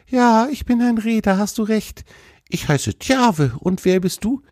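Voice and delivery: high-pitched, pleased deer voice; friendly deer, curious. Acted.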